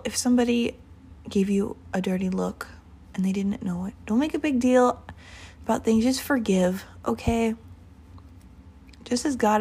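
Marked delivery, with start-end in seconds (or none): none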